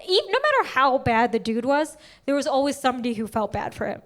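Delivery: normal talking voice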